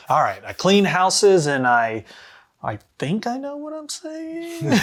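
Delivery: high, slow, unsure little voice; timid character voice. Acted. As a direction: play the nanny with zero confidence. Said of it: "So that lets you kinda get into character".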